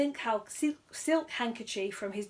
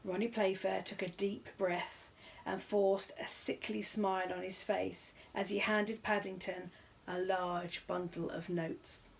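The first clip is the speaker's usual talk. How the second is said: usual speaking voice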